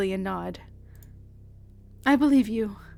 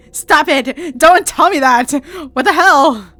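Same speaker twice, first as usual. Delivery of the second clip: loud, raised shouting; upset outburst, much louder; angry protest; 4 semitones higher, 12 dB louder, slower than usual. Acted. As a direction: yell back at him to stop. Refusing his plea not to cry.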